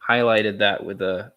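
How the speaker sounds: ordinary talk, nothing marked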